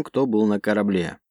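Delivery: usual speaking voice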